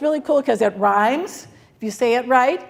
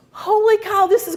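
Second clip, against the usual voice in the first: surprised; 10 semitones higher than usual. Real, not acted. Sees everyone has the app.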